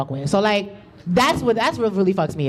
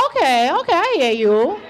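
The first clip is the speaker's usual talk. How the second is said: sassy comeback; 8 semitones higher than usual. Retold or imitated. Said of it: "I said".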